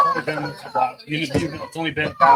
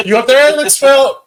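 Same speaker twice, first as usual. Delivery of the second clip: calling out; 11 semitones higher, 12 dB louder, slower than usual. Real, not acted. Calling up to someone in tree.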